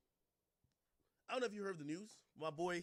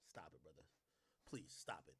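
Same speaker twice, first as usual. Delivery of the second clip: much softer, waving the threat away; dismissive hush; 13 dB quieter than usual. Real, not acted. Mocking a record executive's threats, dismissive hush. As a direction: drop it low and tired.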